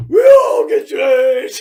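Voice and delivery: slow, loud hammed-up proclamation; Rocky's victory speech; mock speech. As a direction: proclaim it like a boxer to the crowd.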